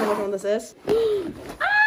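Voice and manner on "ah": excited gasp. Real, not acted. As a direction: gasp then a squeal. Recognizes the book.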